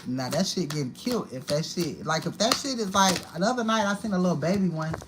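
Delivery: ordinary talk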